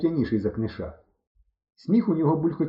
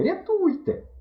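very high, thin wail; cry for help; slower than usual. A narrator's reading. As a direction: squeal out for rescue. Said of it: "я навіть вигукнув оте ганебне".

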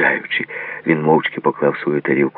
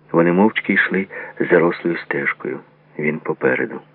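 ordinary delivery, nothing marked

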